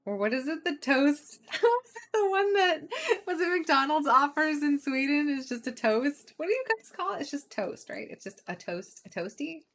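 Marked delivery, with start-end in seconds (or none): 1.5-5.3 laughing. Real, not acted. Laughing while asking.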